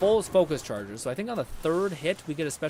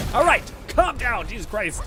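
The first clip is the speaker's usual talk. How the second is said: exasperated shout; 7 semitones higher, 8 dB louder than usual. Real, not acted. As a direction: yell at the screen to calm down. Yelling at game enemy.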